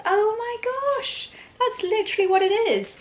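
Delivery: thrilled it finally clicks; delighted realisation. Real, not acted. Realising the parcel is a holiday gift, delighted.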